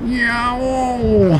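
long, high yowl; cat's yowl. Acted. Cat pointing to the man, yowling.

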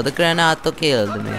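mock Italian accent; put-on Italian accent. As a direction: say it with a playful Italian lilt. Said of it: "I put an Italian accent on it".

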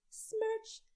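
high squeaky kiss smack; a high, playful smooch noise; kiss sound. A narrator's reading. Mother kissing Andrew goodnight, high squeaky smack.

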